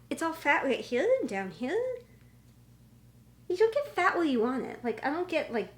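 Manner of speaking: ordinary delivery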